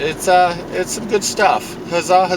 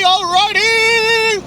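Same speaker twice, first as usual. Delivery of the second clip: much higher, slow stretched alrighty; a slow, high, drawn-out "alrighty!" sung like a cheerful chime; drawn-out sing-song; much slower than usual. Acted.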